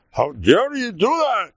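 high, slow mock outrage; drawn-out affronted protest; indignant outrage. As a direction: act scandalized, pitch swooping up. Voicing Vashti's proud refusal.

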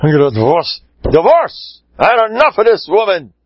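fed-up husband, voice climbing high; acted anger. Husband arriving with his wife to divorce her, angry. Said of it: "He's all angry at her".

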